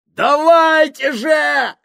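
slow, high yelling over a din; shouting. A narrator's reading. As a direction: bellow it over the roar. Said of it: "заорал Джордж".

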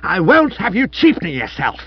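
high, loud commanding tirade; ringing costermonger bellow. A narrator's reading. Wife forbidding husband a knighthood, ringing bellow. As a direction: lay down the law like a street hawker. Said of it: "rang through the room like that of a costermonger".

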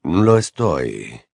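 low, unhurried Snape voice; Snape's reply. A narrator's reading. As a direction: say it slowly, cold and resolute. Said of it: "contestó Snape".

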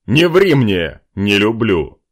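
loud sharp warning; stern threat. Acted. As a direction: bark it at the liar. Nobleman threatens the liar. (грозно)

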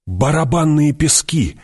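a stifled cry of warning; choked exclamation. A narrator's reading. Jessica realizing they stepped on drum sand, stifled exclamation. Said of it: "придушенно воскликнула Джессика".

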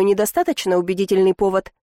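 ordinary delivery

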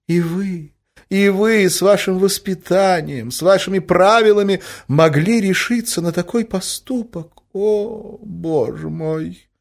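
high, pained lady's reproach, fading at the end; hostess aghast. A narrator's reading. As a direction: lament his conduct as deeply shocked. (потрясённо, с горьким укором)

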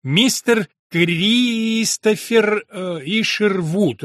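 slow, drawn-out name, as if read off paper; halting, painstaking reading of an unfamiliar name; very careful. A narrator's reading. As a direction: stretch out each name cautiously, high-pitched. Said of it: "очень осторожно, как будто читая из бумаги".

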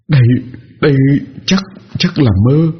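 shaky stammering mumble, high; frightened stammer. A narrator's reading. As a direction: stutter it out in terror. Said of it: "tôi lẩm bẩm nói trong miệng".

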